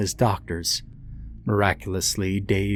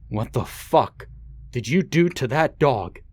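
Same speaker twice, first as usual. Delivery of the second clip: angry demand; 5 semitones higher than usual. Acted. Father demanding answers from his terrified son. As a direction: make the boy answer, seething.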